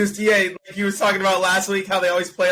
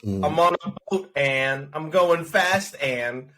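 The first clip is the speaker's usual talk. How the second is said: slow mock plot; much slower than usual. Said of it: "joking Speed plot".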